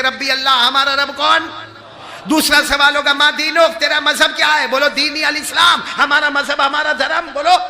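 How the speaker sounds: high, loud preacher's call; preaching at full force. Acted. Preacher quizzing the gathering on the grave's questions, loud. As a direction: rouse the crowd to answer.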